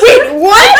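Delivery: high, loud, laughing "wait, what"; mock surprise. Boyfriend hearing the nipple news, surprised. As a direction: play the boyfriend caught off guard.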